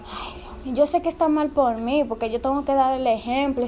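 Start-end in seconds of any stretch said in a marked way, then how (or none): 0.0-0.7 breathless whisper, much softer; whispered dismay. Acted. Whispering her distress to her friend. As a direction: sigh it out in a whisper.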